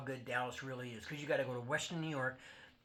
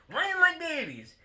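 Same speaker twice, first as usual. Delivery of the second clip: high, loud sneer; mocking jeer; 13 semitones higher, 8 dB louder than usual. Real, not acted. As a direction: throw it back at them mockingly. Mocking Kansas City.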